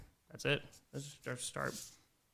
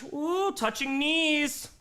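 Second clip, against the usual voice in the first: slow, higher mock scandalized ooh; drawn-out teasing ooh; 11 semitones higher, much slower than usual. Streamer teasing chat about flirting, slow mock gasp. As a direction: stretch it out teasing the couple.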